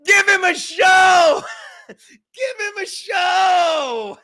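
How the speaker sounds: high, slow, full-voiced shout; rowdy drawn-out yell at the table; big shout. Real, not acted. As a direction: bellow it across the room, laughing. Calling out to co-hosts.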